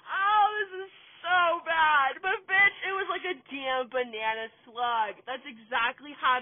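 high, breaking into giggles; laughing. Real, not acted. Cracking up at her own story, laughing through it.

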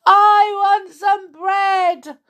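high, slow whiny wail; a small child bawling for bread; wailing little boy. Acted. Little boy wailing to the old woman for bread. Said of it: "wailed a little boy".

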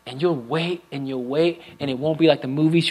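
normal talking voice

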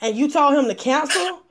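ordinary talk, nothing marked

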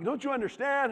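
put-on high-pitched exasperated teen protest; heated pleading. Teenage son to his calm dad at the table, heated. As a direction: plead in hammed-up frustration. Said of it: "I'm heated".

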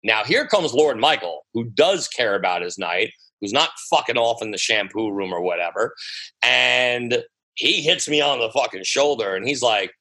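ordinary talk, nothing marked

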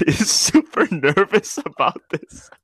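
slow, laughing teasing. Real, not acted. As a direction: giggle through the words.

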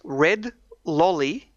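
slowly, word by word; drawn-out teaching read. Shown as a demonstration. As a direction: spell it out slowly for the learner. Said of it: "say slowly with me".

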